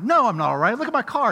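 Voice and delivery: retold frustrated outburst; stewing. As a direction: imitate being fed up, loud opening. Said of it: "I'm stewing".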